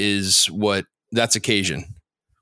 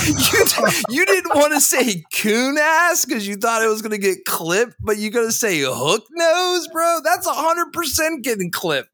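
high-pitched, cracking up in protest; laughing disbelief; 12 semitones higher than usual. Real, not acted. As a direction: call him out through helpless laughter. Laughing disbelief at co-host's remark.

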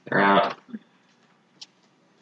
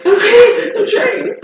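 high strained character voice; 3 dB louder, slower than usual. Acted.

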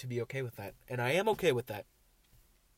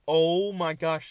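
loud, drawn-out cry of alarm; 5 semitones higher, 10 dB louder, much slower than usual. Real, not acted. Driver spotting a bug in the car, alarmed. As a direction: stretch it out loudly in real alarm.

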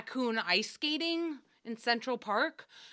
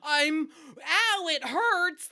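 having a heart attack; 5 semitones higher, 4 dB louder, much slower than usual. Retold or imitated. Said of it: "like, panting? like".

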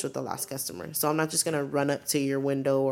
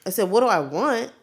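higher and louder, stunned disbelief; incredulous retort; 5 semitones higher, 6 dB louder, slower than usual. Retold or imitated. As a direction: throw the question back, offended. Retorting to the rude cashier.

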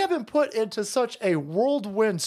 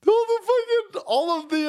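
high, slow, laughing through the words; stunned laughing holy fuck; laughing disbelief; 10 semitones higher, slower than usual. Real, not acted. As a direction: laugh it out in shock.

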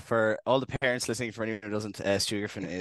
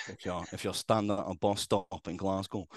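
put-on Glasgow Scottish; amateur Scottish impression; Scottish accent; 5 dB quieter than usual. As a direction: slip back into mock Scottish. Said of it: "such a hard word to say in Scottish".

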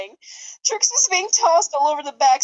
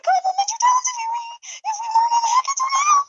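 squeaky, much higher character voice, fast at end; high put-on voice; faster than usual. The bird character remarking on the tornado, squeaky high voice. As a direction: chirp it like a cartoon bird.

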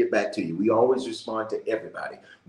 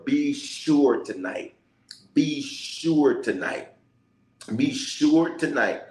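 each word drawn out slowly; slow emphatic plea; 2 semitones higher, much slower than usual. Acted. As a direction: stretch it out, slowly. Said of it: "repeated plea, slowed".

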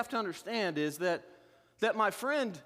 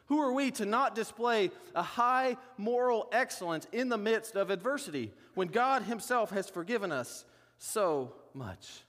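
ordinary talk, nothing marked

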